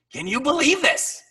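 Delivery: high, loud, amazed shout; yelling over music. Retold or imitated. Bandleader to bandmate while playing on stage, amazed yelling. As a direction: re-enact a yell over the band. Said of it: "We're yelling over the music".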